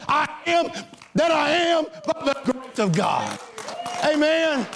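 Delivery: fervent pulpit proclamation, building to shouts; full-force preaching. Acted. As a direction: preach each I am like a battle cry. Declares identity list to congregation.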